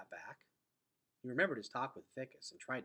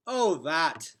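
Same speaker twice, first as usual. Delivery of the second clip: louder offhand brush-off; dismissive; 5 semitones higher, 15 dB louder than usual. Acted. Vicus brushing off Gregor's question about the prophecy, dismissive. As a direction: wave it away. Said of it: "said Vicus dismissively".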